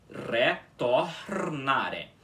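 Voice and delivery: "retornare" very slow, each syllable apart, rolled R; slow syllable demo. Shown as a demonstration. Teacher modelling a hard word slowly for students. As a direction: spell out "retornare" slowly with a trilled R. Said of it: "Então fica aí".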